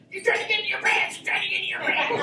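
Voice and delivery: high, hissed whisper of a warning mother; imitated mama's voice in the head, whispery and high. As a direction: nag in a high mama whisper. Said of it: "That's the mama voice".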